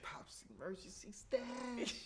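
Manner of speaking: whispered fake sob; hushed, hammed-up weeping; mock crying whisper.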